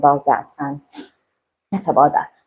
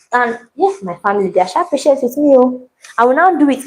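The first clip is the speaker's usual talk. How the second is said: ordinary talk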